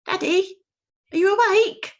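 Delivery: high, loud little owlet's call. Acted. Owlet calling loudly to wake his father. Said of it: "he said loudly".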